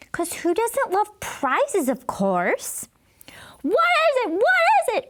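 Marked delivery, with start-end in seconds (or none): 3.6-5.1 put-on high eager kid shouts; excited calling out. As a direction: pester him, pitched way up. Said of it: "we called out".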